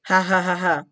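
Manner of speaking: spelled-out fake ha ha ha, a mild mock laugh; a taunting, hammed-up laugh. The whole world mocks beaten Russia with a fake laugh. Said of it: "The entire world just laughs at Russia like".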